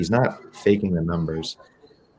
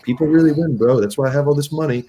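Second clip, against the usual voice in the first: much higher and louder, mock-eager con man; pushy hustler; 8 semitones higher, 7 dB louder, faster than usual. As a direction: egg him on like a dealer who won't let go. Mimicking dealer showing cash.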